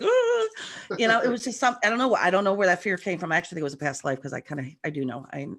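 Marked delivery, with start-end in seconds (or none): 0.0-0.5 a sudden high, loud nervous "Ah"; nervous gasp. Retold or imitated. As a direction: gasp like you're shaking with stage fright. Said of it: "I'm like".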